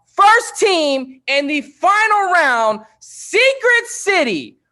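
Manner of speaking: high, loud announcer call; hype host shout. Real, not acted. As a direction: pump up the crowd. Host hypes final round.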